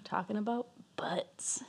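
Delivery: unvoiced whispered aside. Real, not acted. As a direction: breathe it as a whisper.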